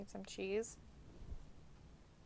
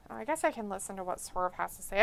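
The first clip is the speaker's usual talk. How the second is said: normal talking voice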